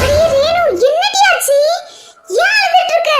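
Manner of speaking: high, loud, alarmed questioning; alarmed concern. Acted. Friend finds Renu crying, alarmed and high-pitched. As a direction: jump in, startled to find her crying.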